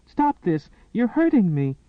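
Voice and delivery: high, loud, breathless cry of pain; panting protest. Acted. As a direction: gasp it out as he drags you. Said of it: "she panted".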